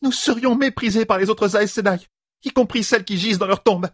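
high-pitched indignant protest; outraged. A narrator's reading. A sister warns of their peers' contempt, outraged. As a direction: protest at the shame of it, voice way up.

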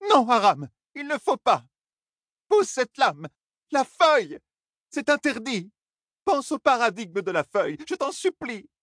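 very high, desperate grandmother's plea; anguished pleading. A narrator's reading. Grandmother tries to wrest the sword from grandson, anguished pleading. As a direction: beg him frantically in a high cry. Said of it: "cria, comme s'il venait de la lui enfoncer".

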